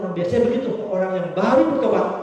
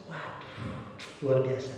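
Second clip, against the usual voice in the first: ordinary talk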